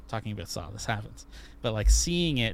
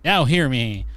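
louder, like calling a hall to order; proclaiming loudly; 3 semitones higher, 10 dB louder than usual. Acted. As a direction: boom it out to get the room's attention. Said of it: "Hear ye, hear ye. A man is speaking".

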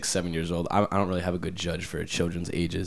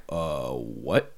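low, softer stunned reaction; mock bafflement; 8 semitones lower, 5 dB quieter than usual. Coworkers hearing the child's order, low baffled reaction. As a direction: drop it low in disbelief.

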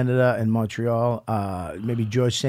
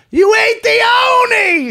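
much higher, louder screaming tearful yell; mock screaming; 6 dB louder than usual. Sister screaming at Dom in the corner over the cake, mock screaming. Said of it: "She starts screaming".

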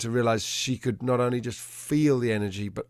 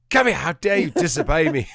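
higher and louder mock outrage; angry command; 6 semitones higher, 6 dB louder than usual. Frustrated owner scolding his dog for not coming, hammed-up anger. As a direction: play the furious owner, half-laughing at yourself. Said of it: "And I was really angry".

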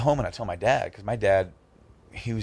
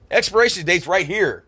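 much higher and louder, mock exasperated; dad's pointed retort, mimicked; 11 semitones higher, 7 dB louder than usual. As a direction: jab the date at him like an annoyed dad. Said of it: "He goes".

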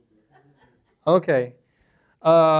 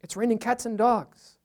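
higher, breezy tourist voice saying the idiom; oblivious tourist; 6 semitones higher than usual. Retold or imitated. Tourist in Mexico telling a local the idiom, higher and breezy. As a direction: toss off the idiom like a tourist who doesn't know it won't land. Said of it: "and they're like".

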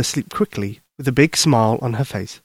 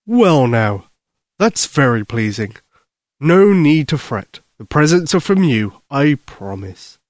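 kindly Santa Claus. Acted. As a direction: soothe a worried child as Santa, unhurried.

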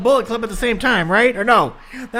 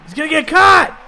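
excited shout; 5 semitones higher, 7 dB louder than usual. Real, not acted. Warning during the match.

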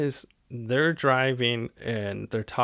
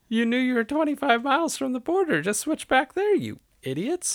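high-pitched exasperated scolding; mock exasperation; 13 semitones higher than usual. Podcast host scolding two dim movie characters, mock-exasperated. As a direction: chide them in a much higher voice.

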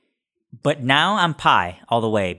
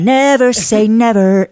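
high, slow, louder mock singer voice; 10 semitones higher, 7 dB louder, slower than usual. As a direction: sing it out like a pop star. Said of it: "To quote [name]".

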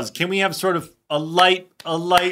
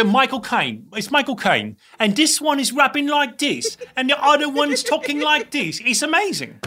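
Michael Caine; 8 semitones higher than usual. Acted. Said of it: "you gotta say".

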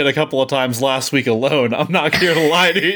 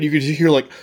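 ordinary talk, nothing marked

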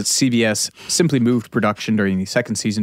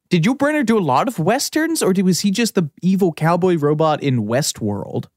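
ordinary talk

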